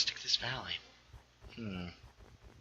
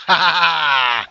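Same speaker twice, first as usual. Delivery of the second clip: loud, slow, mocking cackle in another voice; mock evil laugh; 4 semitones higher, 11 dB louder, much slower than usual. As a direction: cackle like the baby zombie. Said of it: "somebody's laughing".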